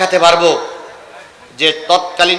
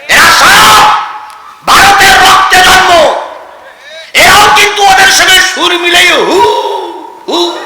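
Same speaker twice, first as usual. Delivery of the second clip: high, loud preaching shout; full-force denunciation; 12 semitones higher, 6 dB louder than usual. Acted. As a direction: shout it in bursts, drawing out the hoot. (গলা চড়িয়ে ওয়াজের তীব্র হাঁক)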